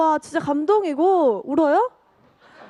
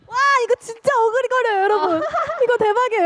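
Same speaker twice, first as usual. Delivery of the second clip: excited laughing; 7 semitones higher than usual. Real, not acted. Excited over embarrassing card.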